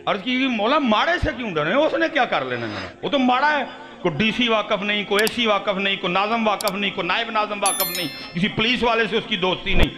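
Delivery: high, impassioned sermon delivery; fiery preaching. Acted. Preacher rousing the congregation, impassioned and high.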